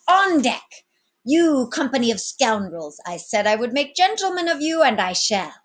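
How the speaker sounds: high, loud captain's command; rally the scoundrels with authority. Acted. Captain summoning his crew, loud and commanding.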